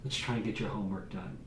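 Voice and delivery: hushed, coaxing vow not to yell; quiet promise to son. Retold or imitated. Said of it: "I'd say".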